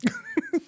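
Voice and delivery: high, airy burst of laughter. Real, not acted. Host laughing at the cualquiera joke. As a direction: let out a high squeal of a laugh.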